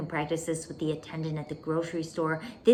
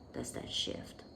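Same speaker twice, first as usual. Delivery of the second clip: whispered question; 8 dB quieter, slower than usual. Shown as a demonstration. Coach asking students if the energy shifts, whispered. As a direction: whisper it much softer and lower.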